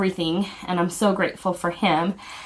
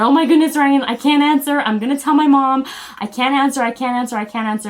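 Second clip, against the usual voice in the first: high, loud giddy protest, tailing off; retold flustered outburst, easing as it repeats; flustered panic; 6 semitones higher, 5 dB louder than usual. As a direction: act out panicking at a ringing phone. Retelling panic as mom phones.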